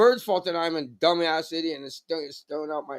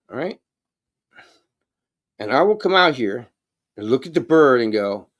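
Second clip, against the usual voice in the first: usual speaking voice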